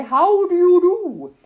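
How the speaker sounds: loud, high, drawn-out greeting; stretched-out, high-pitched hail; calling out. A narrator's reading. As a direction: hoot it out slowly across the water. Owl flies over calling greeting.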